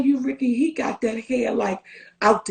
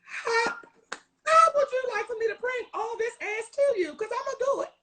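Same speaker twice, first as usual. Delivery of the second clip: high, giggling tease; higher, laughing mock eagerness; playful laughing; 10 semitones higher than usual. Real, not acted. Playful answer to husband.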